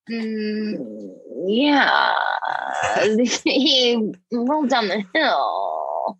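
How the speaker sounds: slow, vague, dazed reply; lightly voiced tipsy liar; evasive drunk. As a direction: mumble like you forgot the story. Impersonating drunk evasive woman.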